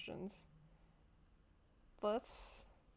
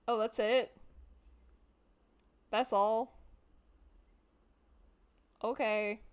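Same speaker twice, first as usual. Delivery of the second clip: loud letdown; 7 dB louder, slower than usual. Real, not acted. Loud disappointed reaction.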